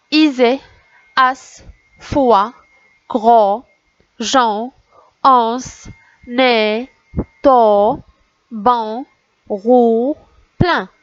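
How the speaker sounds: drawn-out syllables with pauses between; syllable drill. Shown as a demonstration. Teacher reading the next row to children, measured and separated. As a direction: deliver each syllable as its own firm, held unit. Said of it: "Je vais vous relire les syllabes".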